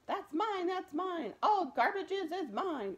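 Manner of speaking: high, loud possessive yell; shouted. Acted. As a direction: scream it like a mad garbage king. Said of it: "Ernest shouted".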